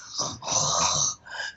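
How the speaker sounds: long, low, drained sigh; weary sigh. Pretend. Acting the worn-out worker coming home, a long low sigh. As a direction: let all the air out like the day is over. Exhausted after long day.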